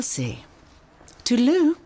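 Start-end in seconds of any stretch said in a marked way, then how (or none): 1.2-1.8 breezy goodbye. Real, not acted. Official leaving brightly.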